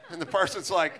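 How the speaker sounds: ordinary talk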